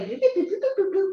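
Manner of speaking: high, bright, bouncy boops with a laugh; cheerful Cherry O-Yo guy. Retold or imitated. Imitating the Cherry O-Yo guy's happy boops. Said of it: "And how he'd be like".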